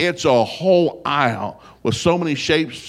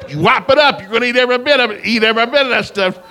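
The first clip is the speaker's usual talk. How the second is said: loud, higher, insistent command; stern order; 10 semitones higher, 6 dB louder than usual. Retold or imitated. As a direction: lay down the law to the son. Dad ordering son to eat.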